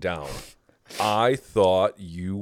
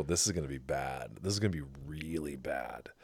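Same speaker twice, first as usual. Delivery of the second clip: inner dread; 7 semitones lower, 13 dB quieter than usual. Retold or imitated. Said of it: "And I go".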